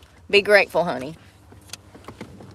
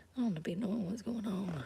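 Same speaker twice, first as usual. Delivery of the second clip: whisper; 19 dB quieter than usual. Real, not acted. Muttering to herself in truck.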